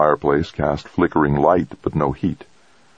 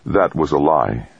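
plain reading voice

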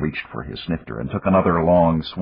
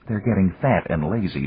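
ordinary delivery, nothing marked

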